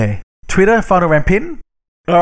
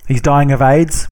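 ordinary delivery

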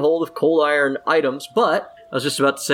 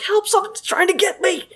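put-on scared kid calling. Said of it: "and he's like".

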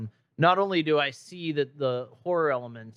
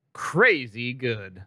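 ordinary talk

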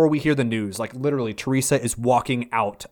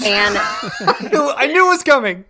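laughing while talking; 9 semitones higher, 7 dB louder, slower than usual. Real, not acted. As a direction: giggle through it. Laughing at the hat toss.

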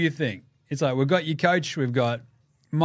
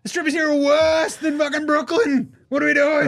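much higher, louder betrayed whine; outraged star; 12 semitones higher, 4 dB louder than usual. Retold or imitated. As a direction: shriek at being lied to. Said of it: "And he goes there, he's like".

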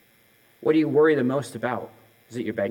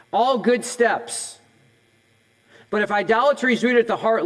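higher, louder pulpit push; full-force preaching; 6 semitones higher, 4 dB louder than usual. Acted. Pastor pressing the congregation on money idols, full-force preaching. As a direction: drive it home to the congregation.

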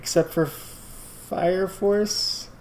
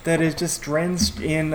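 normal talking voice

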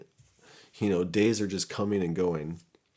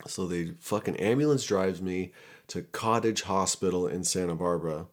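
plain reading voice

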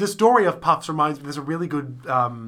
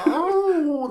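high, drawn-out ghostly moan; Moaning Myrtle; 12 semitones higher than usual. Shown as a demonstration. Co-host demonstrating the impression everyone can do, high comic moan. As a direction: wail a long high "ohh". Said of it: "like that sort of thing".

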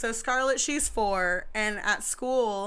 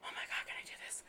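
anxious whisper; 17 dB quieter, much faster than usual. Retold or imitated. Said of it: "I still get all like".

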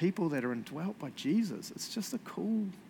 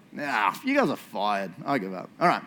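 mock dismissal; 5 semitones lower, 3 dB louder than usual. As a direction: grumble like a fed-up boss. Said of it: "mock firing congregation".

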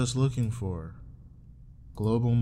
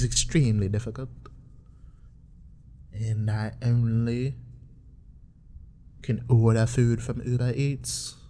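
hammed-up country accent, louder and rising in pitch; country guy accent; 3 semitones higher, 3 dB louder than usual. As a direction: declaim a melodramatic letter in a put-on country accent. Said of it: "It's a country guy writing his Australian girl".

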